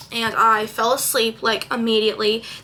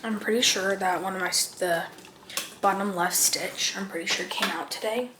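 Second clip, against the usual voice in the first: hushed, close to the mic; quiet night voice; 11 dB quieter than usual. Real, not acted. Quiet night routine talk.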